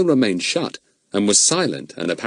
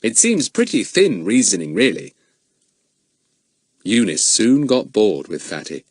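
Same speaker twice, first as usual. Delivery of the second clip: ordinary delivery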